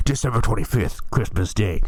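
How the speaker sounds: lightly put-on low, deliberate voiceover; imitated movie voiceover. Co-host joking about a Christmas movie, imitated voiceover. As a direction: mimic a solemn dated narration. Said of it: "That's what he does".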